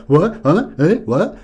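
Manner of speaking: loud, puzzled huhs; baffled what. Retold or imitated. Executives asked what they liked, in loud baffled surprise. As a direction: sound caught off guard and confused.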